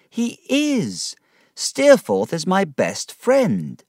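high, slow, firm protest; insistent defense. A narrator's reading. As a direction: stand up for your friend. David defends Steerforth.

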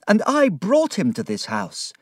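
high-pitched, dismayed exclamation; horrified cry. A narrator's reading. Narrator realises he brought Steerforth here, horrified cry. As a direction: cry it out in self-reproach. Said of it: "I cried".

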